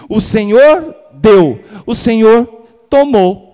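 each word weighed and ringing out, slow and loud; proclaimed slowly and loud. Acted. Preacher booms Job's words to the congregation, slow and loud. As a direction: hold out every word of the verse, loud and slow.

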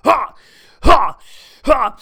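high, loud huffing "ha" bursts; mock heaving "ha, ha, ha"; dog's retching imitation. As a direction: imitate a dog gagging with sharp breathy huffs. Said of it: "he's going".

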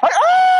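husband reenacting his scared scream; high scream. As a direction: scream like you got scared out of your skin.

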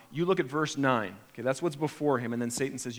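ordinary talk

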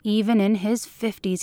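ordinary delivery

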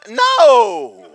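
loud, high drawn-out shout; exasperated shout. Retold or imitated. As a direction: wail it out like a worn-out rower. Exhausted rowers answer Jesus back.

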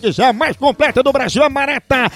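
lively radio ad read. Acted. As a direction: sell the coffee brightly. Reads a coffee advert. (leitura de comercial de rádio)

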